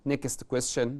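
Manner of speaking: usual speaking voice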